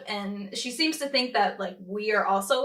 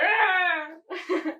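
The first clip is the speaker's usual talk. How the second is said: cartoon gasp; 8 semitones higher than usual. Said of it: "it's like".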